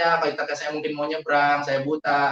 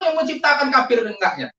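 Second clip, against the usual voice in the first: retold challenge, pitched high; indignant challenge; 9 semitones higher, 4 dB louder than usual. Man retelling how he challenged his accuser, indignant. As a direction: throw the question back, voice lifted.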